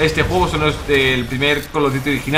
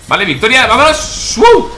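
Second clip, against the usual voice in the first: high, loud triumphant cheer; victory whoop; 8 semitones higher, 8 dB louder, slower than usual. Real, not acted. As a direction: cheer the win loudly. Winning the match.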